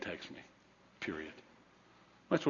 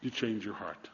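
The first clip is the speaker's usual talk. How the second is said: ordinary talk, nothing marked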